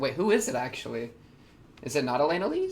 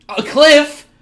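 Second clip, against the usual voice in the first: shocked surprise; 12 semitones higher, 14 dB louder than usual. Real, not acted. Reacting to a scene.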